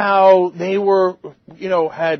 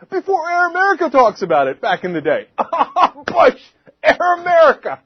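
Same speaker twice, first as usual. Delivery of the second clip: laughing; 7 semitones higher, 4 dB louder than usual. Real, not acted. Laughing at own joke.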